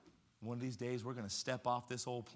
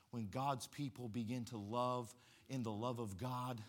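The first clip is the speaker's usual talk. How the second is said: normal talking voice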